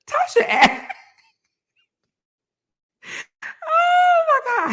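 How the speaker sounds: high, squealing breakdown into laughter; real laughing fit. Real, not acted. Host cracks up recounting Tasha's question, helpless high laughter. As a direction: lose it mid-sentence, wheezing high with laughter.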